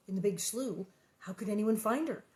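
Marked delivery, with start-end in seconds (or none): none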